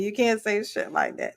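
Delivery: ordinary delivery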